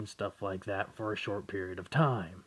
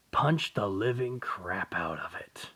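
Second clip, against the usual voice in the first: normal talking voice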